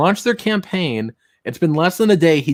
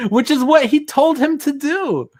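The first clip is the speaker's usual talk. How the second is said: much higher, incredulous aside; exasperated aside. Real, not acted. Host scoffing at the contradiction, high and incredulous. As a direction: pitch it up in disbelief.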